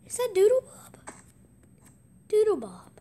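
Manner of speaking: high, loud startled question; shocked at spotting him; surprised. Real, not acted. Gamer spotting Doodlebob in the map, shocked and loud.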